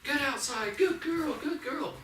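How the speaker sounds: high happy praise for a puppy that just went outside; super positive praise. Shown as a demonstration. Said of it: "make it super positive".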